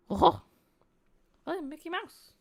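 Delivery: high squeaky cartoon mouse voice; mock Mickey Mouse. Mocking the player with a squeaky Mickey Mouse voice.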